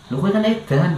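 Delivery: high, blunt teasing voice; blunt caretaker. Retold or imitated. Caretaker tells the confused patient he is mad, high blunt voice. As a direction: tell him plainly he is crazy. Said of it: "gitu bahasanya".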